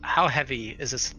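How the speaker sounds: ordinary delivery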